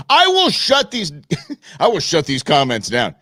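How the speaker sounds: laughing mock threat.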